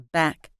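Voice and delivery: "back" with the final k stopped, not released; unreleased K. Shown as a demonstration. Teacher contrasts back with bag, "back" with unreleased k. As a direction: end "back" on a closed k without a puff. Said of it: "The first one isn't released".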